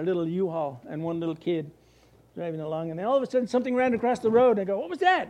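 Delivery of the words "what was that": high, startled cry "what was that". Retold or imitated. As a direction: jump at something on the road. Startled by a gator.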